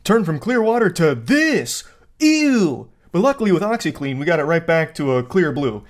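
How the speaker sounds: mock pitchman with swooping, overdone intonation; old infomercial. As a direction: hype it up like an old TV ad. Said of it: "sound like an old infomercial".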